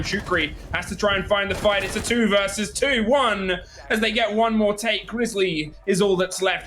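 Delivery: rising hype esports call; excited play-by-play. Acted. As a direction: build the call to a peak. Play-by-play round climax.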